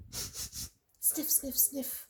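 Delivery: soft, hushed sniffs; soft sniffing. Acted. Storyteller sniffing for Nell, faint and soft. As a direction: sniff quietly, far off. Dog sniffing far away.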